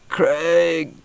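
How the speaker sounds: mock panic. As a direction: beg your mate for help in mock panic. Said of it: "kinda looking over you and go".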